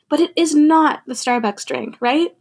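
usual speaking voice

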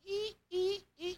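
high, thin "ee" squeaks; soft, high-pitched creaking noise; imitated squeaky swinging. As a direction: squeak like a dangling taillight. Imitates a dangling taillight swinging.

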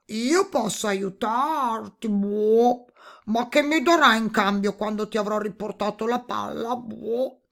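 lightly voiced toad with croaks; bargaining toad. A narrator's reading. Toad offering help for a reward, bargaining toad voice. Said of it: "Il rospo allora disse".

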